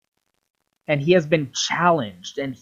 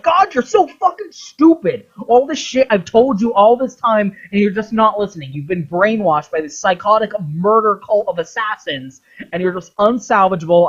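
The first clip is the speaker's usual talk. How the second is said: furious tirade; 4 semitones higher than usual. Retold or imitated. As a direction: scream the insults at him in a high furious voice. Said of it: "And he's like".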